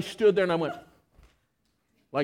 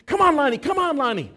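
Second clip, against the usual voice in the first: higher, louder call to the dog; urgent calling; 8 semitones higher, 6 dB louder, faster than usual. Retold or imitated. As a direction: urge the dog on, loud and high. Urging dog running from pit bull.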